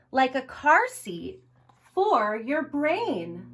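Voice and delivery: slow, gentle read-aloud pace; reading to children. Acted. Librarian reading Skulls to children, slow read-aloud. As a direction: read it slowly to little ones at story time. Reads the picture book aloud.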